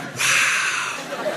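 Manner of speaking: breathy whispered wow; soft and awed under the breath; impressed whisper. Retold or imitated. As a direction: cop quietly impressed, whispering. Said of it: "He was like".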